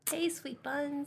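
high, soft playful call; teasing mock greeting. Friend teases storyteller with his new nickname, mock greeting. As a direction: coo it as a pretend flirty hello.

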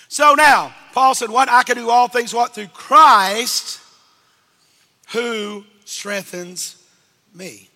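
loud, building sermon delivery; preaching. Acted. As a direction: preach it out, then slow and drop. Preacher quoting scripture.

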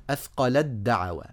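أثقلت دعوى said as "athqalad-da'wa"; one doubled d joins أثقلت to دعوى; التاء merged into الدال. Shown as a demonstration. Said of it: "دخلت من اللام إلى الدال على طول". (إدغام تاء "أثقلت" في دال "دعوى")

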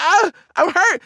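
high put-on groaning plea; hammed-up pretend pain; faking hurt. Kid insisting to mom after the crash, faked hurt. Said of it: "just act like you hurt".

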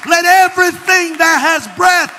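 high, loud revival preaching; full-force preaching. Real, not acted. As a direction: shout it to the congregation in driving phrases.

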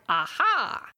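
bright, eager exclamation of discovery; a cheerful eureka Aha!; excited aha. Shown as a demonstration. Host demonstrating the wrong kind of aha, excited eureka. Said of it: "The aha moment was a bit more like".

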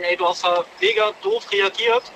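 mock reproach. Hypothetical reproach from others.

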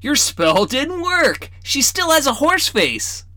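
high, slow mocking kid tattle; teasing boy. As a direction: tease the sister to grandpa. Boy teases sister.

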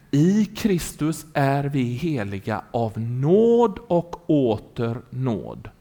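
very slow, weighted delivery; solemn declaration. Real, not acted. Preacher gives the congregation the starting point, slow solemn declaration. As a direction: draw out each word with deliberate weight.

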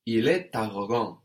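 "Il est arrogant" firmly, T linking into "arrogant"; optional liaison. Shown as a demonstration. Teacher repeats the liaison for the student, "Il est arrogant" with sounded T. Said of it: "with our optional but very common liaison".

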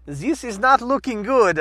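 slow, put-on Austrian accent; worried understatement in a mock Austrian accent. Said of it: "half my family fled Austria".

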